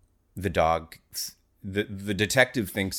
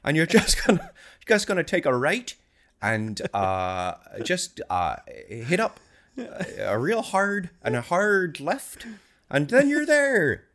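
high, loud hammed-up Newfoundland accent; 12 semitones higher, 6 dB louder than usual. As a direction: ham up a Newfoundland local giving directions. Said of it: "gonna need to hear more of that, uh, Newfoundland accent there".

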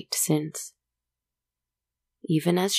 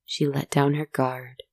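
ordinary delivery

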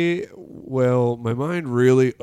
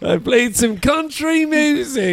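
higher Irish lilt, proud of it; Irish accent, pitched up; 11 semitones higher than usual. Acted. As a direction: boast like Patty the Irish traveller. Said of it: "talk to my Irish character".

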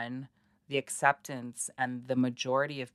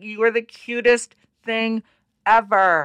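gushing; 8 semitones higher, 7 dB louder than usual. Retold or imitated. Gushing at a small child.

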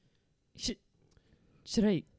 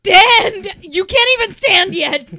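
loud, high mocking yell; mocking shout; 11 semitones higher, 18 dB louder than usual. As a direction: jeer at him loudly. Mocking the mayor in puddle.

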